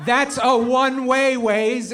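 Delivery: slow, high, loud objection; acted exasperated yell; exasperated protest. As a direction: punch each word at the stubborn driver's guide. Passenger protests louder second time.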